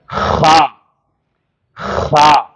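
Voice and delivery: خا rasped from the throat; practice kh. Shown as a demonstration. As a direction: scrape خا from the throat again. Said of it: "اس کو ایسا پڑھو". (مشق کے لیے خا حلق سے)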